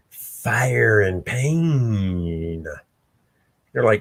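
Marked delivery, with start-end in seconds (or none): 0.1-2.7 menacing villain. Acted. Villain's menacing drawn-out line.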